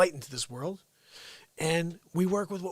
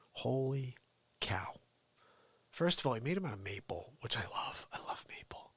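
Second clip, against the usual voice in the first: awed whisper; 7 semitones lower, 10 dB quieter than usual. Real, not acted. Hushed awe at the seats.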